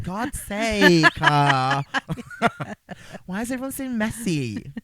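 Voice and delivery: high, exasperated put-on monster voice; Creep. Monster complains about mess after his rounds, mock-exasperated. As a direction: grumble in a silly high character voice. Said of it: "that's probably Creep's voice".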